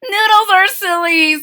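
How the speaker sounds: high, loud character voice; silly little sister. A narrator's reading. Jezebel jokes with her sisters about noodles, silly high voice.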